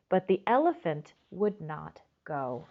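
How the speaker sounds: ordinary delivery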